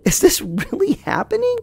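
high-pitched incredulous wondering; half baffled. Retold or imitated. Teller left waiting, thinking to himself, baffled. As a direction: squeak it to yourself in disbelief. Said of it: "half baffled".

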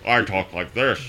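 a hint of Sean Connery; Sean Connery impression. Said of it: "slightly American Sean Connery".

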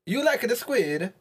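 slow, high mock Italian question; Italian accent. Player questioning friend's food choice in a mock Italian accent. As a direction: ask it slowly in a hammy Italian accent, high-pitched. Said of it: "speak with an Italian accent".

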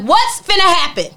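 loud, high, stretched-out challenge; retold confrontation. Confronting a weird man on an elevator, loud challenge.

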